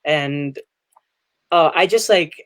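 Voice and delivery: ordinary talk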